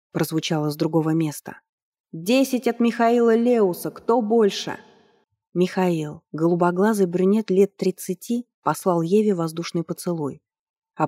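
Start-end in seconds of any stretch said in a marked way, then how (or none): 2.1-4.8 high, booming auctioneer call; ringmaster. Acted. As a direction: announce it to the whole hall. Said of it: "громогласно объявляя имена".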